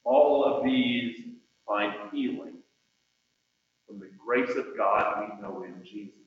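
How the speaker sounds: ordinary talk, nothing marked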